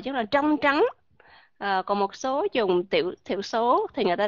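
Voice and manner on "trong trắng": "trong trắng" in a Southern accent with a retroflex tr; giọng miền Nam; Southern Vietnamese accent. Shown as a demonstration. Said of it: "người miền Nam đọc là trờ".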